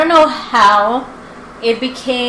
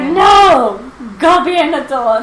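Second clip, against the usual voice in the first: mock teacher refusal; 4 semitones higher, 6 dB louder, slower than usual.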